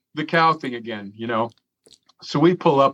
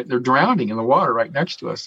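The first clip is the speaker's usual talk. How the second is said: usual speaking voice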